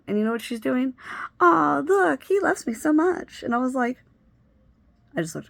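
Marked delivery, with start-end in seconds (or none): 1.4-3.4 high, louder mocking coo; mock gushing. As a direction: parody her smug delight. Mocking friend's gushing reaction.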